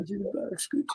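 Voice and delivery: soft, low creepy whisper; low menacing murmur; creepy killer voice. Retold or imitated. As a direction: hush it with quiet menace. Movie quote reenacted.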